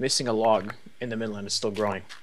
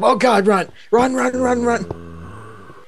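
panicked yelling, much louder, higher; being chased; 11 semitones higher, 9 dB louder, much slower than usual. Real, not acted. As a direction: scream to run away. Creepers chasing in game.

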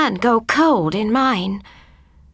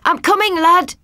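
high, louder call to someone ahead; an old man calling back, higher and louder; 8 semitones higher, 4 dB louder than usual. A narrator's reading. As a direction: shout reassurance into the dark. Grandad calling to the voice.

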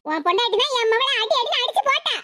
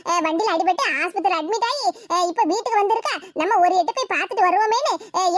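usual speaking voice

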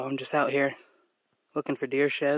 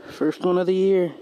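happy, raised and louder; excited; 4 semitones higher, 6 dB louder than usual. Real, not acted. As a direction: cheer over the first find. First shed picked up, cheering.

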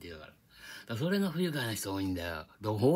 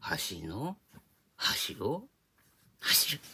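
standard Japanese accent; much slower than usual. Shown as a demonstration. Teacher contrasting with Kansai, standard rising pitch accents. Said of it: "これ関西弁でやると".

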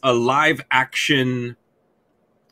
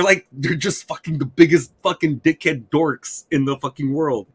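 ordinary talk, nothing marked

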